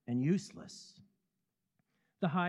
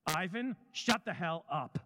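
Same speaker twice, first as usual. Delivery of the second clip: mild mock shout; 5 semitones higher than usual. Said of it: "a man's voice shouting back".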